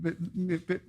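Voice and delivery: ordinary delivery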